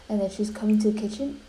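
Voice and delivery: ordinary talk, nothing marked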